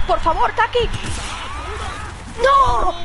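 beg, then yell in dismay; desperate plea. Real, not acted. Pleading then despair at miss.

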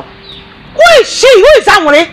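angry shouting. Acted. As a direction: daughter shouting about the housegirl. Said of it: "why are you so angry".

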